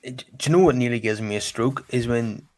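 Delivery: ordinary talk, nothing marked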